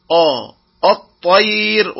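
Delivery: dark 'o'-like آ, then الطير begun the same way, slow; heavy hamza. Shown as a demonstration. Tajweed teacher isolates the mistake, heavy آ in الطير. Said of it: "فيفخم الهمزة".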